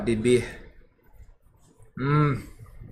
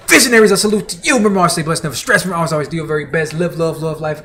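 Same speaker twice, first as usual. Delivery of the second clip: higher, louder sign-off chant, racing; rally the viewers with your farewell motto; big catchphrase outro shout; hyped sign-off; 7 semitones higher, 8 dB louder, faster than usual. Acted.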